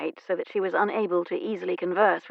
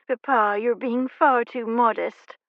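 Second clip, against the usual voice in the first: mild scolding; 4 semitones higher, 4 dB louder, slower than usual. Acted. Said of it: "Poppy scolded".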